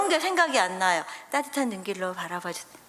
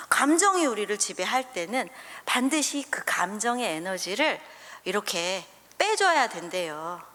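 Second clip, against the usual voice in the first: normal talking voice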